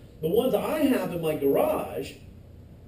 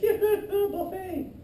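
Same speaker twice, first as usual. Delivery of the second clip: spider voice; 11 semitones higher than usual. Pretend. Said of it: "and they're like".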